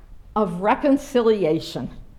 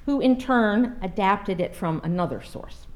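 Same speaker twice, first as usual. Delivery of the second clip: normal talking voice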